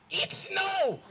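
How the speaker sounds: high, loud mock German "It's snow!"; exaggerated German accent, high and loud. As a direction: shout it like a baffled German. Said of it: "a German, I assume".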